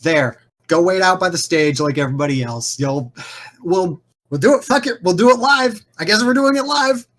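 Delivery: stressed organizer cracking under deadline pressure; exasperated boss. Acted. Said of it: "is all like".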